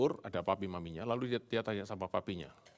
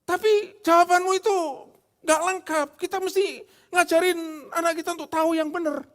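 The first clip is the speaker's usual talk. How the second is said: very high, loud mock quarrelsome wife; hammed-up squabbling wife; wife arguing; 11 dB louder than usual. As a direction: insist like a wife who won't back down. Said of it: "Istrinya bilang".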